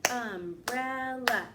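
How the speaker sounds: umbrella stretched slowly, each syllable clear. Shown as a demonstration. Teacher modelling for the class, slow and syllabic. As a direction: draw out umbrella in beats. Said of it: "One more time".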